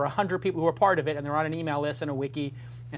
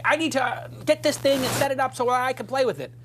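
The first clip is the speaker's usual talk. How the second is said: higher, louder bossy demand; imitating himself; 8 semitones higher, 4 dB louder than usual. Host mimicking himself ordering tech guy around, mock bossy. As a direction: play the impatient boss asking for gadgets. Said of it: "when I say".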